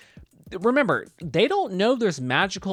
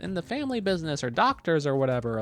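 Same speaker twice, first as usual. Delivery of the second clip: higher-pitched mock parental nagging; disapproving parents; 6 semitones higher than usual. Parents to Mario and Luigi about the family business, mock disapproval. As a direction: imitate parents belittling a career choice. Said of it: "Mario and Luigi's parents".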